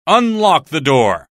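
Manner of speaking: the American orders the barman to unlock the door; firm command. Acted. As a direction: give the order deliberately. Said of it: "said the bearded American".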